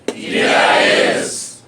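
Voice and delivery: loud, drawn-out slow chant; group shout. Real, not acted. Audience answers the host on three, loud shout. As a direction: shout it back together, slowly.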